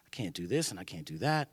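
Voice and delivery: low, soft, timid excuses; mildly afraid. Acted. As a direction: shrink back into a small, defeated voice. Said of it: "we're so afraid".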